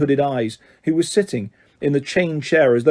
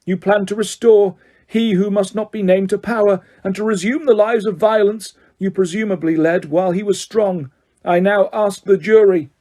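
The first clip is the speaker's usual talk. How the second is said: raised, higher forceful charges; bellowing judge; 6 semitones higher than usual. A narrator's reading. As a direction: hammer the accusations. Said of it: "bellowed Mister Crouch".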